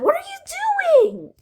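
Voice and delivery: high, startled what are you doing; shocked high question. Retold or imitated. Kid catching friend deleting the house, high shocked squeal. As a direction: squeal it in disbelief.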